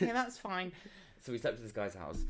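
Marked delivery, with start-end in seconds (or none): 0.0-0.7 fake polite. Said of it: "I was like".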